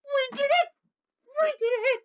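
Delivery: sobbing joy, very high; crying out in relief; giddy relief. Real, not acted. Crying with joy after win.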